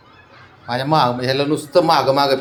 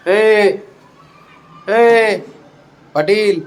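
louder, higher "ए"; calling out; 5 semitones higher, 3 dB louder, much slower than usual. Real, not acted. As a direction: call sharply to get his attention. Calling out to sulking pet.

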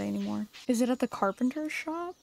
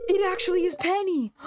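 high, loud thrilled exclamation; excited discovery; 8 semitones higher, 3 dB louder than usual. Real, not acted. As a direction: burst out with delight at finally finding her. Finally finds Penny after searching.

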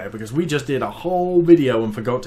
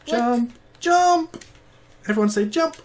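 high, excited chant; rally everyone to jump; excited cheer; 10 semitones higher, slower than usual. Acted. Cheering on jumping bee.

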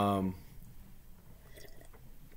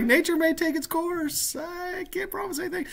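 comic high voice; 5 dB quieter than usual. Acted. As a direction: draw it out in a mock high pitch. Repeats comic put-on reply.

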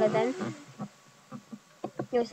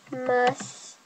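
normal talking voice

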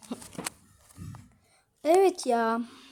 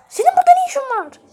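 startled shout; 13 semitones higher, 8 dB louder, faster than usual. Acted. Startled at the cave intruder.

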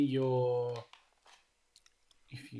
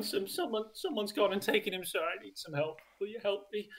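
much higher, frantic voice with a nervous laugh; a desperate little man begging a dragonborn for help; panicked father NPC; 9 semitones higher than usual. Acted. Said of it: "He looks up at you and goes".

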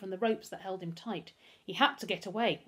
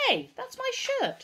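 very high, loud, drawn-out whine of protest; 6 dB louder, slower than usual. A narrator's reading. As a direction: object indignantly at the top of the range. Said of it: "Humphrey protested".

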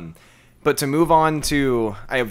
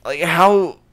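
high, loud, laughing through the words; can't stop laughing while asking; laughing disbelief; 7 semitones higher, 7 dB louder, slower than usual. Real, not acted. Telling a friend about a clueless caller, laughing incredulously.

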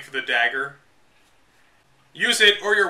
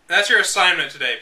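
usual speaking voice

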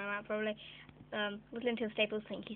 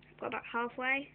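ordinary delivery

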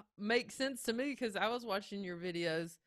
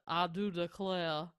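hammed-up Savannah Southern belle; Savannah accent; 3 semitones lower than usual. Southerner mocking bad screen accents, overdone Savannah drawl. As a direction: imitate a fake genteel Savannah accent. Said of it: "that Savannah type accent".

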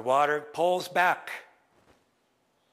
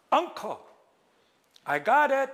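much higher, bright announcing call; proud call; 8 semitones higher, 4 dB louder than usual. Acted. Raven to his uncle, proud excited call. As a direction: show off the catch.

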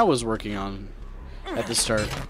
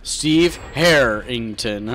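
slow drawn out; 6 semitones lower, much slower than usual. Pretend. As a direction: stretch out Steve Harrington very slowly. Echoed slower.

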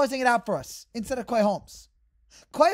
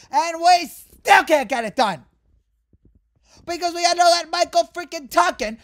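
angry outburst; 6 semitones higher, 7 dB louder than usual. Real, not acted. Angry rant.

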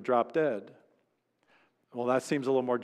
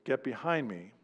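quiet, softer rebuke; 6 dB quieter than usual. Retold or imitated. Preacher quietly voicing Jesus rebuking Peter. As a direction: say it gently, almost under the breath. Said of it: "how is that gentle to say to Peter".

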